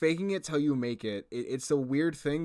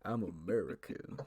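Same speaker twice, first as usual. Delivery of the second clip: cowboy voice; 5 semitones lower than usual. Acted. Joins the McCree bit.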